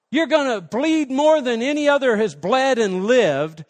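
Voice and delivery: loud, high, building sermon delivery; fervent preaching. Acted. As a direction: hammer home what Jesus endured. Preaching at full force.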